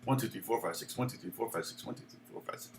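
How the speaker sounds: soft, low counting under the breath; counting out rhythm. Shown as a demonstration. Guitar teacher working out how to count the lick, soft rhythmic count. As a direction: count the beat quietly and evenly. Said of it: "You could count it that way".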